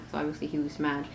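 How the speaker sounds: ordinary delivery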